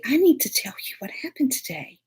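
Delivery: hushed whisper. Retold or imitated. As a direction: whisper it like a secret. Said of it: "And I say".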